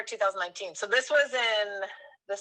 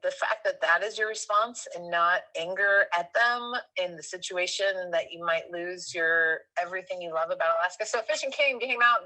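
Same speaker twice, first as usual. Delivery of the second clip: normal talking voice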